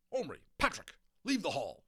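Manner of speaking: loud angry headmaster; angry teacher order. A narrator's reading. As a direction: throw them out of the hall.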